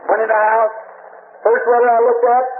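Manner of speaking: usual speaking voice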